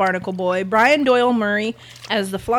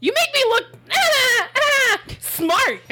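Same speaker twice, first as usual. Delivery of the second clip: much higher, broken by wailed ahs; high-pitched outburst; slower than usual. Acted. Firing back at teasing co-hosts, high yelping outburst. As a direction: interrupt yourself with high yelps. Bit with cry-like interjected noises.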